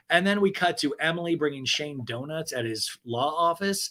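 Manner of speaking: normal talking voice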